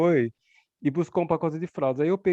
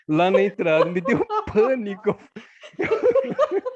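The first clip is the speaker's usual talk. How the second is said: louder, higher, breaking into laughter; laughing; 5 semitones higher, 5 dB louder, slower than usual. Real, not acted. Admitting to friends a panic at the market, laughing. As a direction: laugh through the words.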